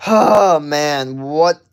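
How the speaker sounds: high, loud outburst at the nonsense; loud exasperated disbelief. Real, not acted. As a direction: groan it out loudly, baffled. Baffled by random chat questions.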